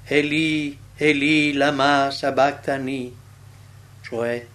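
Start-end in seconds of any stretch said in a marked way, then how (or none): 0.0-3.2 loud, slow, level cry to God. A narrator's reading. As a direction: cry it out solemnly, drawing out the words. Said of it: "gridò con gran voce".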